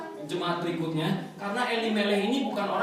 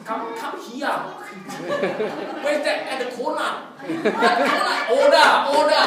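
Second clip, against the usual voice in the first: exaggerated Aussie accent, higher pitched; Australian accent; 5 semitones higher, 5 dB louder, slower than usual. Preacher mocking how Australians talk, hammed-up Australian accent. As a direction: mimic an odd Australian accent. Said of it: "Accent Australia kan agak".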